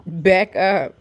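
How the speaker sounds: loud sharp order to back up; firm command to dogs. Real, not acted. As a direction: push the dogs back with your voice.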